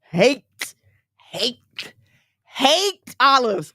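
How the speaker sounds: "hate" punched out alone, with pauses between; emphatic hate. Real, not acted. Speaker telling the audience how much they loathe olives, emphatic "hate". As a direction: draw out "hate" slowly, each one its own hit.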